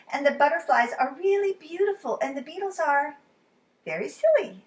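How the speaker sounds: put-on high, delighted little girl; Lola voice. Lola explaining bugs to Charlie, high and delighted. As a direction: giggly wonder at silly beetles.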